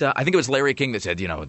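ordinary delivery

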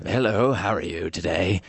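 slow put-on British voice; measured British greeting, lightly caricatured; Edward Gorey. Shown as a demonstration. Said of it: "my version of Edward Gorey".

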